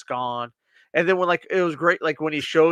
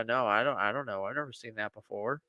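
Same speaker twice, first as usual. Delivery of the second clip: mumbled nervous denial; 6 semitones lower, 10 dB quieter than usual. Retold or imitated. Nandor denying he knows the picture.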